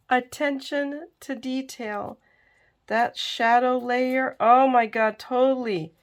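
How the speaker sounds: gushing. Real, not acted. Gushing over shadow layer.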